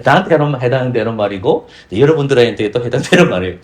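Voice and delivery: ordinary delivery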